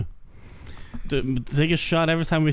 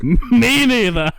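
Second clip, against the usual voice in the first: laughing; 9 semitones higher, much slower than usual. Real, not acted. Laughing reply after a joke.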